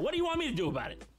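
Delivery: exasperated. Acted. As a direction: throw up your hands, fast. Boss exasperated reply.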